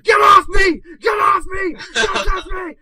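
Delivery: high, full-force panicked scream; yelling and screaming. Retold or imitated. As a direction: scream as if in a night terror. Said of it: "like, yelling and screaming".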